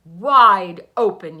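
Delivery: very loud, slow yell. A narrator's reading. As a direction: bellow the order slowly. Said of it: "yelled his wife".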